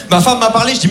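usual speaking voice

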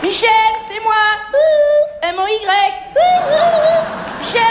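high, sing-out call to get attention. Acted. Shop manager hailing employee Michel, calling out. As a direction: hail someone with high, drawn-out hoots.

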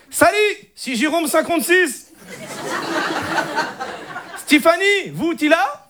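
put-on high-pitched Moroccan Arabic accent; exaggerated North African accent in French; Hicham, Moroccan accent. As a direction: cousin with a thick Moroccan accent. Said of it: "l'accent arabe".

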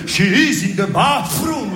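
slow, high, exaggerated schoolroom English accent; overdone English accent. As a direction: overwork the tongue on a mock English accent. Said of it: "Avec l'accent, il fallait répondre".

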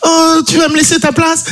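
high-pitched wife impression. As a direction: squeak it out like his wife. Wife's voice acted.

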